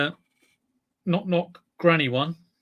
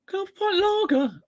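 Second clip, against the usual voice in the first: very high, thin little cartoon voice; high-pitched cartoon creature asking for a pint; squeaky string voice; faster than usual. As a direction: squeak it like a tiny piece of string. Disguised string orders.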